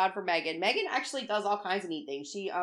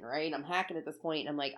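ordinary delivery

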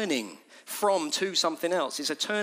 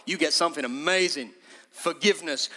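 ordinary talk

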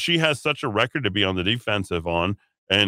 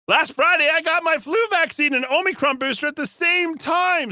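much higher, hammed-up prim official voice; mock official voice; 3 dB louder than usual. Mocking the health secretary's vaccine announcement, hammed-up high voice.

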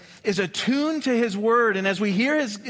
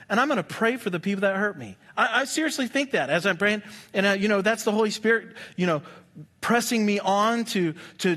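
ordinary talk, nothing marked

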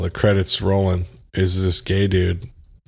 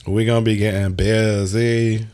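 exaggerated, stretched-out "busy"; playful imitation with a drawn catchphrase; mimicked movie interviewee. Co-host reuses the interviewee's catchphrase as a teaser, playful mimicry. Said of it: "you can mimic his voice perfectly".

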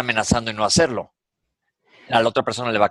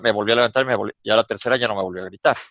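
ordinary delivery

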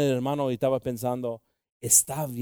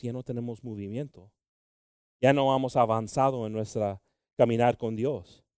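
normal talking voice

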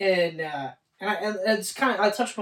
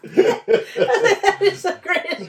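slow, higher, laughing delight; 11 semitones higher, 4 dB louder, much slower than usual. Real, not acted. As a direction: savour it through laughter.

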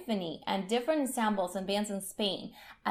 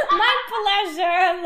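high, loud excited laugh; laughing excitedly; 11 semitones higher, 9 dB louder, slower than usual. Real, not acted. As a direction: laugh through the words, giddy. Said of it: "'cause I'm so excited".

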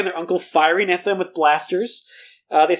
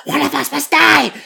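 high, louder eerie hiss; hissing in his face; 8 semitones higher, 4 dB louder than usual. A narrator's reading. Sister hissing into her brother's face, eerie and loud. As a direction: spit it at him like a threat. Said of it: "hissing all up in his face".

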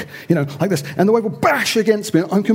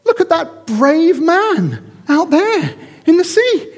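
put-on much higher, louder child voice, amazed; awed little boy; 11 semitones higher, 5 dB louder than usual. Boy on promenade calling to his dad, amazed high child voice. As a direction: squeal like a wide-eyed boy. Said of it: "I imagine the boy says".

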